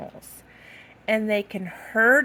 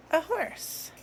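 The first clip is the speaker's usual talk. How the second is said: gasping, high surprise; 8 semitones higher, 4 dB quieter than usual. Acted. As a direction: act amazed for the kids. Surprised reveal to kids.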